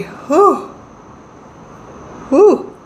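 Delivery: long audible yawn; big yawn. Real, not acted. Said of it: "I just yawned really huge".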